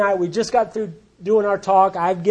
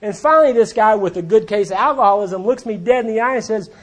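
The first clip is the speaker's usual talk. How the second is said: usual speaking voice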